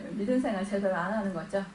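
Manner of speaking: preacher answers his own question, laughing and soft. Shown as a demonstration. Soft laughing reply to the congregation. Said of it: "안 하는 거예요?".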